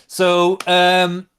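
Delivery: normal talking voice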